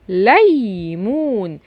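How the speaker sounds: "ليمون" drawn out very slowly; slow demo reading. Shown as a demonstration. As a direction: stretch out "ليمون" for the kids. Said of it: "السكون بيخطف اللي قبله".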